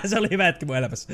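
rapid words through a laugh. Real, not acted. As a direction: chuckle as you say it. Laughing at himself.

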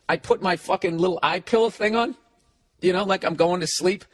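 ordinary talk, nothing marked

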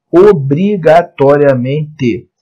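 "obrigatoriamente" slow, each syllable separated; sílaba por sílaba. Shown as a demonstration. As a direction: spell out "obrigatoriamente" in deliberate, emphatic syllables. Teacher stresses a key rule. ("obrigatoriamente" devagar, sílaba por sílaba)

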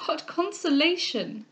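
high, drawn-out lament; plaintive, acted distress; weeping. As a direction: cry out slowly in sorrow. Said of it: "cried the weeping Cecilia".

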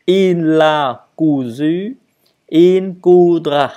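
loud, slow teacher's drill; emphatic model for learners to copy; model conjugation. Shown as a demonstration. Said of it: "pareil ici".

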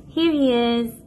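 normal talking voice

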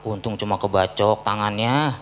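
lightly voiced gossiping neighbour, low and soft; resigned bystander. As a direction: sigh it like a shrugging neighbour. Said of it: "Apa kata orang-orang?".

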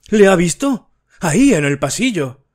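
shaken exclamation. A narrator's reading. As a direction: gasp it out, shaken by what she saw. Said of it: "exclamó impresionada".

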